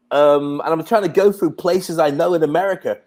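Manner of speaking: usual speaking voice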